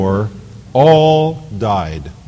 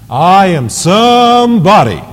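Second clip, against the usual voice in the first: higher, louder, slow preacher's cry; Jesse Jackson oratory; 8 semitones higher, 6 dB louder, slower than usual. Retold or imitated. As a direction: ring it out like a great orator. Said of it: "That's how he would say it".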